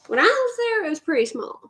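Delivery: a hint of the Southern drawl, pitched high. Shown as a demonstration. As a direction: let the Southern accent slip out. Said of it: "I'm gonna trigger it".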